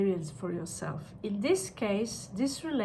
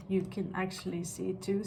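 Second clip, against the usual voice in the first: ordinary talk, nothing marked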